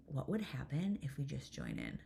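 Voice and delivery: softly floated question; quiet suggestion. Retold or imitated. Mom quietly proposing an idea to her daughter, quiet suggestion.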